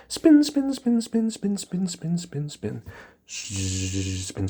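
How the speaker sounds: starts very high and loud, then sinks and fades; a siren-like spin winding down; high spin, spin fading to plain; spinning sound. Shown as a demonstration.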